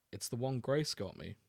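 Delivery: ordinary talk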